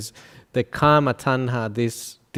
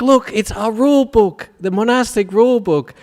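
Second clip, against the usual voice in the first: very high, loud squealing insistence; shrill out of sheer scandal, pointing; hammed-up high outraged monk; 10 semitones higher, 5 dB louder than usual. Monk showing the rule book.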